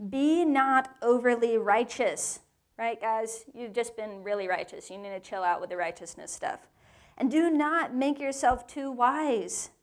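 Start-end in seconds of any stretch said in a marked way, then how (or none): 0.1-2.4 slow, weighty reading; slow scripture quote. Shown as a demonstration. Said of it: "Chapter seven, verse sixteen". 7.2-9.7 slow, weighty reading; slow scripture quote. Shown as a demonstration. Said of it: "Chapter seven, verse sixteen".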